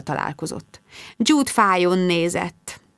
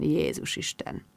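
low-voiced, dark mutter; very soft and ominous; dark, grim; 3 semitones lower, 10 dB quieter than usual. A narrator's reading. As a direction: drop to a hushed, grim undertone. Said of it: "ő ennyit mondott sötéten".

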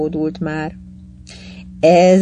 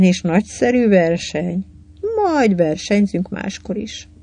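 plain reading voice

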